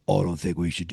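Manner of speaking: low, slightly British-sounding retold voice; accidental British accent. Retelling a mentor's reluctance to his young partner, in a hint of British accent. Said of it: "And he's not British".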